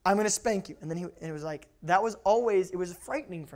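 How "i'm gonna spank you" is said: imitated stern order, building louder; angry dad. Dad losing temper orders child to bed, stern mock anger. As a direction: order the kid sternly, punching the repeat. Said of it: "when he would walk in and go".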